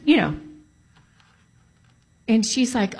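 usual speaking voice

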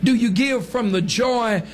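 high-pitched fervent preaching. Acted. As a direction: keep preaching with rising fervour.

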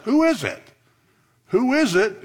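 rising preacher's challenge. Acted. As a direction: press the hearers to name him.

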